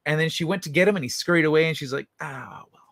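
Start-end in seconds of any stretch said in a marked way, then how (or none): 2.2-2.8 very soft resigned cat voice; voicing the cat. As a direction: shrug it off softly as the cat.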